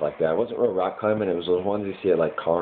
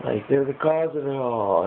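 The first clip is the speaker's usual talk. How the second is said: half-hearted yell, pitched way up; mild mock yell; 8 semitones higher than usual. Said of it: "But I was yelling at".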